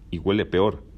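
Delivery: usual speaking voice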